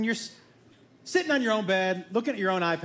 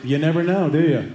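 low, loud, knowing reply; loaded, teasing comeback; coy brother; 5 semitones lower, 7 dB louder than usual. Acted. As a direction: deliver it low and leading. Acted sly brother's reply.

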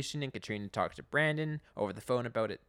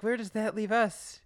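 imitating Eugene; 10 semitones higher, 7 dB louder than usual. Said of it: "Eugene's like".

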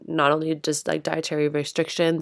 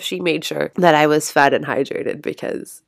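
ordinary talk

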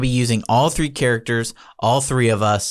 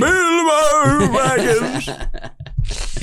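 drawn-out, much higher Gandalf-style Bilbo Baggins; much slower than usual. Retold or imitated. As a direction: stretch the name slowly like Ian McKellen's wizard. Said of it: "Sir Ian McKellen".

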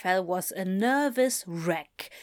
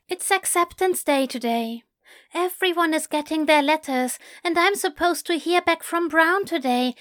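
put-on higher, louder, rushing nervous young voice; anxious rambling student; 7 semitones higher, 5 dB louder than usual. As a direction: spill every worry out in one breathless stream. Narrator voices anxious Aziraphale.

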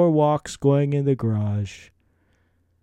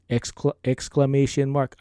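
ordinary talk, nothing marked

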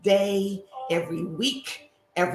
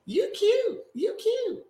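much higher, gushing with delight; squealing greeting. Real, not acted. Greeting her friend Serena with a delighted high squeal. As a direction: squeal it like spotting your best friend.

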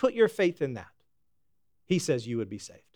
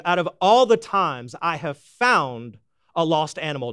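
usual speaking voice

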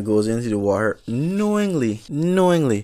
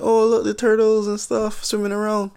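much higher, airy sightseer gushing; cheery tourist voice; 11 semitones higher than usual. Retold or imitated. Tourists on the boat gushing over turtles, high cheery voice. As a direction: play the clueless happy tourist.

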